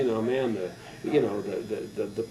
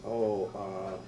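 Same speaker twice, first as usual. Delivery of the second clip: low, soft, hesitant answer; retold sheepish admission; sheepish son; 4 semitones lower, 8 dB quieter than usual. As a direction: mumble it low to your mom.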